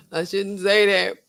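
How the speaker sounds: "I shouldn't say that" drawn out slowly through a laugh; slow and laughing, half-guilty. Real, not acted.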